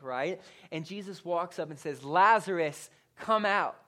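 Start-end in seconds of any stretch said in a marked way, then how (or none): 2.0-3.8 loud, commanding summons; calling out. Acted. Jesus calls dead Lazarus from the tomb, commanding. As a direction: call it out with authority.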